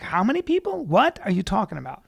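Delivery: high, loud disbelieving question; acted-out astonishment; incredulous. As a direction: jump up high in disbelief, then come down. Acting out disbelief at audience size.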